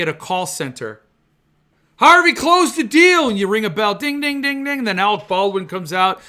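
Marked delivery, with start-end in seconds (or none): 2.0-3.3 much higher, louder triumphant call; mock sales announcement. 3.9-4.8 high sung ding ding; mimicking the sales bell, lightly sung; bell imitation.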